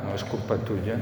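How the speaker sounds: usual speaking voice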